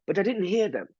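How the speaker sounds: put-on high, proper English-accented voice; prim British cashier. Mimicking the cashier insisting she heard nothing. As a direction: insist primly. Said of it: "super proper".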